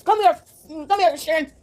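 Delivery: high call of come here; mother calling Sharon over; mama calling. Acted. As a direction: summon the kid sharply.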